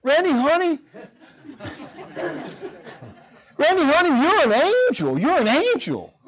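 high, sugary Southern lady voice with a laugh; sweet Southern lady. Retold or imitated. Neighbor lady fondly correcting the pastor, sweet high Southern voice. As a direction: play a doting Southern neighbor gushing. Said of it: "typical nice Southern gal".

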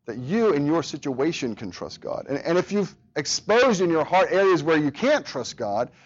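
ordinary delivery

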